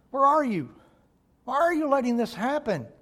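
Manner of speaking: pleading cry. Retold or imitated. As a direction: cry out to an absent God. Imagined cry to God.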